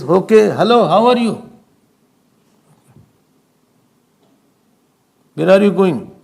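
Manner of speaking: a curt, offhand hello with no real interest; neglectful greeting. Retold or imitated. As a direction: greet them as if you'd rather not. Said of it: "If you have to neglect somebody".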